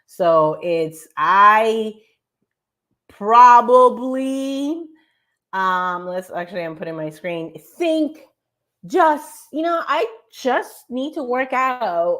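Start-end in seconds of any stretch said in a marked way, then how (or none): 1.2-4.9 louder, slowly drawn out; nice and loud. Shown as a demonstration. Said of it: "nice and loud". 7.8-12.2 higher, wishy-washy hedging voice; mock hedger. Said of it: "people who talk like this".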